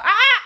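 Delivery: sudden loud high scream; excited scream. Real, not acted. Vlogger screams while telling the birthday story, too loud. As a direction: let out a loud squeal of excitement. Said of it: "Too loud".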